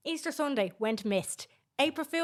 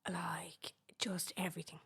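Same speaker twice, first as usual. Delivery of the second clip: whispering; 4 semitones lower, 10 dB quieter than usual. Acted. Whispering complaint.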